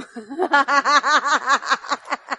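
long staccato laugh; rapid ha-ha laughter; real laugh. Real, not acted. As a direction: burst out laughing after the punchline. Laughs after quoting Mauricio's line.

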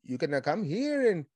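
bad Irish accent slipping into Mexican; lilting fake Irish that drifts Mexican; botched Irish accent. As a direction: joke apology in a badly faked Irish accent. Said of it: "put a Mexican in my Ireland accent".

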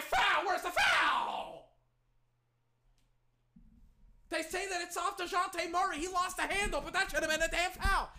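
real high, loud yelling; furious protest. Real, not acted. Fan screams at referees over a missed foul, furious.

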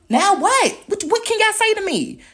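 high, loud taunting challenge; defiant shout. Real, not acted. Ranting at critics, a high loud taunting dare. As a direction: dare them to answer, full voice.

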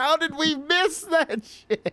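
very high, drawn-out squeal of disbelief; incredulous outcry. Retold or imitated. Telling co-hosts about rewatching the debut, incredulous outcry. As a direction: squeal it slowly in exaggerated disbelief.

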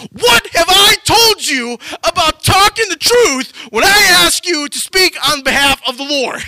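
high, loud acted shout; hammed-up outraged yelling; furious scolding. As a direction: berate him like an exasperated king. King angrily scolding the prophet.

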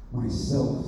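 low, soft and rumbling; deep, earth-shaking voice. A narrator's reading. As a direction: let it sink deep. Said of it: "very deep and low, so the earth shook".

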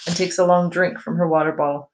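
ordinary delivery

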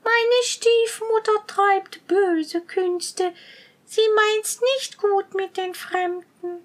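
put-on high, slow, sorrowful girl's voice; sighing maiden. A narrator's reading. Girl warning strangers about her stepmother, sighing sadly. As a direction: confide it mournfully, drawn out and high. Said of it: "Das Mädchen seufzte und sprach".

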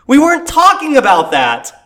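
high, loud, incredulous put-on voice; hammed-up disbelief with a laugh; mock baffled outrage. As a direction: play the baffled reader, high and indignant. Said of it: "And you're like".

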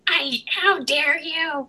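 high, slow, tearful mock outrage; retold outrage at the cheating ex; outraged cry. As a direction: rail at him like the furious letter. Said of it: "a two-page letter swearing at him".

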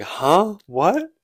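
loud, high "huh, what", jolted; shocked outburst. Retold or imitated. Mom hints her daughter likes him, he yelps in shock. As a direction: blurt it out, caught off guard. Retold quote, shocked by offer.

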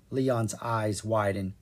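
ordinary delivery

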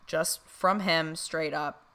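ordinary talk

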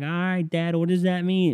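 put-on high-pitched child voice; put-on little-kid question; imitated kid. Voices kids answering dad in joke.

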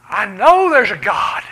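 loud, slow declaration; retold joyful shout. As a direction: proclaim it like a healed man. Healed man's retold declaration.

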